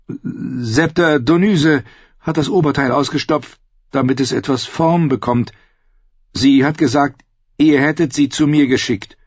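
Brienne's voice. Acted. Narrator voices Brienne higher.

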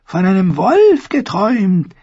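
high, slow dreamy crone voice; high old woman, slow and mournful; old dwarf seeress. A narrator's reading. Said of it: "sagte die Zwergenfrau".

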